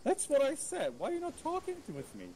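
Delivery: indignant. Real, not acted. Ignored prisoner complains indignantly.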